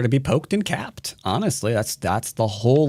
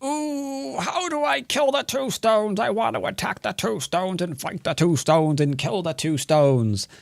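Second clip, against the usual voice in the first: high-pitched parody of a fixated player; mock eager beginner; 7 semitones higher than usual. Streamer imitating a player set on killing two stones, mock eager voice. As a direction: put on a high voice, leaning on "two stones" each time. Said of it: "I keep emphasizing the words two stones".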